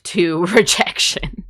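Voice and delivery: laughing through the word, slowly; a small laugh breaks into "to rejection". Real, not acted. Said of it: "I'm abnormally sensitive".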